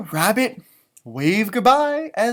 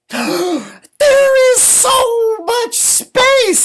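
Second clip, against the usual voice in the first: much higher, louder, slow amazed cry; overjoyed Rabbit; 14 semitones higher, 10 dB louder, much slower than usual. Acted. Rabbit marvelling at his empty house, high and thrilled. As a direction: burst out with delight at the space. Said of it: "what does Rabbit say".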